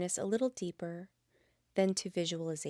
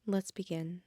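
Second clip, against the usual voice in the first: gentle Yoga Nidra guide voice; guided meditation. Acted. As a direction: ease the listener into stillness.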